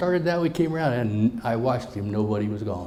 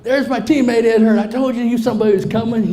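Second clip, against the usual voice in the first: higher, much louder announcing; excited call-out; 8 semitones higher, 10 dB louder than usual. Retold or imitated. Ray to the parents, announcing his teammate with a loud call. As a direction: shout it across the room in triumph.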